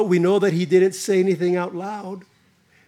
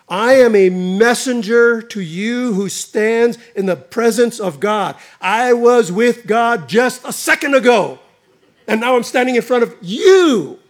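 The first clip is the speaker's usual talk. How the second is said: loud, firm angel declaring; Gabriel's voice; 4 semitones higher, 5 dB louder than usual. Acted. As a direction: announce who you are with weight. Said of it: "Almost an indignation".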